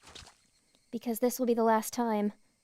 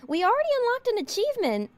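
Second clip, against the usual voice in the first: excited; 11 semitones higher, 5 dB louder than usual. Real, not acted. Streamer reacts to achievement.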